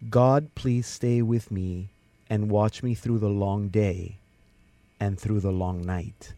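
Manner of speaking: slow, unhurried prayer recitation; prayer reading. Real, not acted. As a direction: give each line room, like praying aloud. Host reads a listener's prayer.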